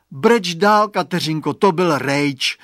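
loud, put-out call; acted disgust. A narrator's reading. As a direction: announce a letdown, fed up, dragging the end. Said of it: "řekl znechuceně Radovan".

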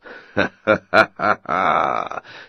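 deep roaring laugh; giant's booming laugh. A narrator's reading. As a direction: laugh like a huge ogre. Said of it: "dal se do velikého smíchu". (hromový smích obra)

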